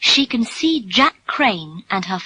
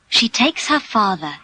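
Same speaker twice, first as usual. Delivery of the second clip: usual speaking voice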